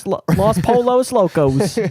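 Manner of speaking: loud, high, anglicized Spanish; Spanish in a hammed-up English accent; bad English-accented Spanish. Shown as a demonstration. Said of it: "like, an English accent".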